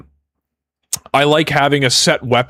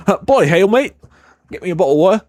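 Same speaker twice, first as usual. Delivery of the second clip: higher-pitched caricature Englishman; mock English accent; 5 semitones higher, faster than usual. Streamer asking chat about types of Englishmen, in a mock English accent. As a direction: play a broad Brit swallowing consonants in an English accent. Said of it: "those types of Englishmen".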